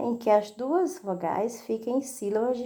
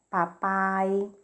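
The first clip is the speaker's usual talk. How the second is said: normal talking voice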